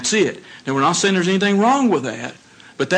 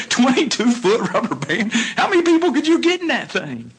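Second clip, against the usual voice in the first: playful amazement; 6 semitones higher than usual. Real, not acted. Joking amazement at big band.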